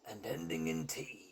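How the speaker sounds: hushed unvoiced whisper. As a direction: whisper the hint. Hinting secretly.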